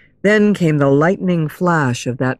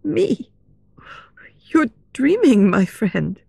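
high, unsettled heroine voice; nervous high-pitched denial, dropping at the end; trembling voice; 7 semitones higher than usual. A narrator's reading. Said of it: "In a trembling voice she said".

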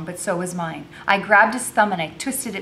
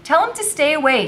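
exasperated order; 10 semitones higher, 7 dB louder than usual. A narrator's reading. Becky orders the girl.

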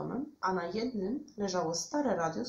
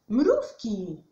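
loud, higher burst; excited exclamation; 4 semitones higher, 10 dB louder than usual. A narrator's reading. Bear watching ants through a magnifier, exclaiming. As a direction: exclaim it with delight. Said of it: "wykrzyknął Miś".

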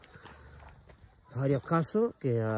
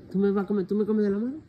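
higher, playful questions to an animal; pet talk; 8 semitones higher, faster than usual. Real, not acted. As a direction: tease the pet in a high voice. Cooing at the animal eating. (voz aguda y juguetona a la mascota)

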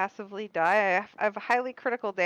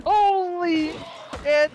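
surprised; 6 semitones higher, 6 dB louder, much slower than usual. Real, not acted. Shocked outburst at game.

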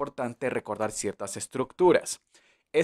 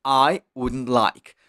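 slow model sentence; 3 dB louder, much slower than usual. Shown as a demonstration. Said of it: "¿Queda claro?".

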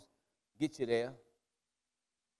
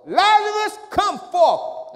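high, loud summons to the dead; loud call; 14 dB louder, slower than usual. Retold or imitated. Preacher calling Lazarus from the tomb, loud. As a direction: call him out of the grave, full voice. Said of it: "with a loud voice".

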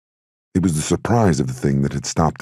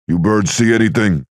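raised voice, higher and louder; yelling across; 7 semitones higher, 5 dB louder than usual. A narrator's reading. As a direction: call across to the next rooftop. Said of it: "Luca yelled across".

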